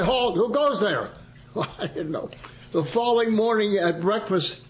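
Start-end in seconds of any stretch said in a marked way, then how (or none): 0.0-1.1 higher, barked halt, who goes there; correct sentry call. Retold or imitated. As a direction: show him the proper call. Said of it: "you're supposed to say".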